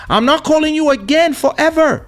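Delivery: mock indignant vow. Mock vow to cut friend off.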